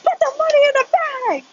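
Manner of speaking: very high-pitched cartoon holdup squeal; SpongeBob-episode robber. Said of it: "like, that SpongeBob episode".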